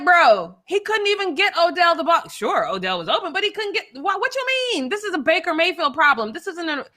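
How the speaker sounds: high-pitched, incredulous rebuttal; re-enacted disbelief at a bad take; exasperated protest. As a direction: argue back in a high, worked-up voice. Re-enacts arguing with commentator.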